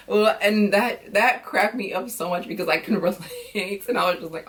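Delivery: usual speaking voice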